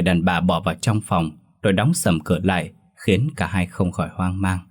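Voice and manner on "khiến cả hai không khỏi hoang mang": plain reading voice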